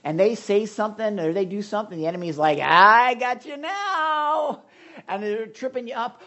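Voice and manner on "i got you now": high, slow taunting enemy voice; gloating enemy. Retold or imitated. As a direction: draw it out like a smug villain. Enemy taunting in retold scene.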